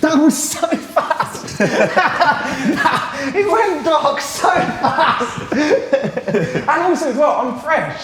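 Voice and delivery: slow, high, laughing through the words. Real, not acted. Host to Chris after being choked out, laughing. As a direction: giggle in disbelief.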